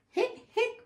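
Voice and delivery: very high, squeaky hics; hiccups. A narrator's reading. Hippo can't stop after fizzy pop, high hiccups. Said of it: "I'm hiccuping bubbles".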